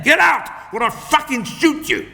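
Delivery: high, strained gunman's shout; thug's threat. A narrator's reading. Carjacker threatening the trapped woman, high strained shout. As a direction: bark it at the driver.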